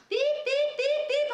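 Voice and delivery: imitating a monitor, very high and loud; heart monitor beeps. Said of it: "the heart monitor going".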